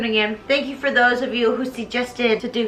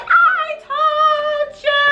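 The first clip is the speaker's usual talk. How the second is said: very high, long drawn-out cry; mock vindicated wail; 14 semitones higher, 4 dB louder, much slower than usual. [name] to the others after being taken, exasperated wail. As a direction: stretch each word into a high theatrical wail.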